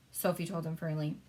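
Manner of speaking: plain reading voice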